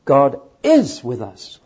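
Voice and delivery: slow, with is leaned on; God is with us, pressing on is; slow and loud, weight on is; stress on IS. Shown as a demonstration. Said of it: "Meaning number two".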